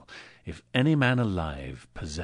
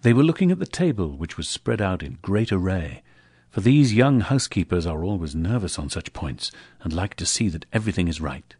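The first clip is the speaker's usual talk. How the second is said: ordinary delivery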